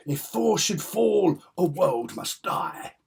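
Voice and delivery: loud, slow, high melodramatic proclamation; high-stakes drama. Acted. Fan acting out father versus son comic drama, melodramatic. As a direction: declaim it as doom for all creation. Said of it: "all that high stakes drama".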